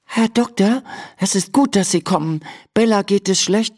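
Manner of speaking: high, light voice for an anxious Emma; worried Emma. Acted. Emma tells the little doctor her horse is unwell, worried.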